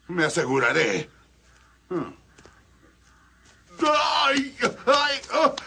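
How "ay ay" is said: sharp high yelps of pain; pain cry. Acted. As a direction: cry out as you fall hard. El ogro se cae. (gritos de dolor)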